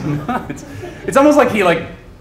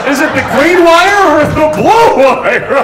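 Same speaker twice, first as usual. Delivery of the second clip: high, loud, drawn-out frantic question; hammed-up desperate panic; mock bomb-defusing panic; 11 semitones higher, 10 dB louder, slower than usual. Bomb defuser choosing a wire, high loud panic. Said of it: "two people trying to defuse a bomb".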